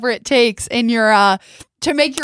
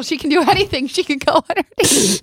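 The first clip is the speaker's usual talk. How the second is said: higher-pitched, laughing through the words; amused laughing; 5 semitones higher than usual. Real, not acted. Host joking about what the guest will do, laughing. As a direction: tease her with a laugh in the voice.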